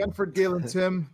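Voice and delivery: usual speaking voice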